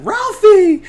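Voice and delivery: very high, loud imitated mom's shriek; mock scream. Mom yells at her son, mock screaming. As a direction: screech the kid's name in mock outrage. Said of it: "the mom starts screaming".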